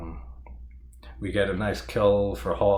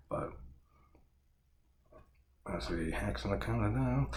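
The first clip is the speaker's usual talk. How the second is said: ordinary delivery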